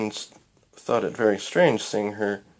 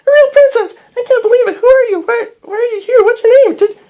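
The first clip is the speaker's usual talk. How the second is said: very high, loud fangirl squealing; excited scream; 13 dB louder, faster than usual. A narrator's reading. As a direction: scream in a breathless rush of questions. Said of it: "Twilight screamed".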